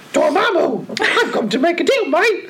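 high, laughing mock Cockney; Cockney Doctor Strange. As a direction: bargain with Dormammu like a Cockney. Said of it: "So, okay, so".

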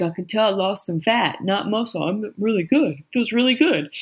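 playful impression of your father; dad's voice. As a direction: quote dad in his put-on voice.

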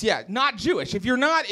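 ordinary talk

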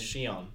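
"Shion" starting with a sh sound. Shown as a demonstration. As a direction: pronounce the X in "Shion" as sh. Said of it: "Like X I O N is".

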